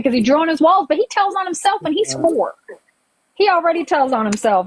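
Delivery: normal talking voice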